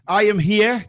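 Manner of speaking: normal talking voice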